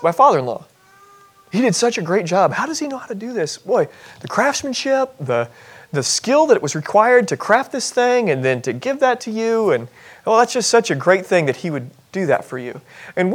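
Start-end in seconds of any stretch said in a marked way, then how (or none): 1.5-12.8 hushed praise. Pretend. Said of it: "started praising the builder".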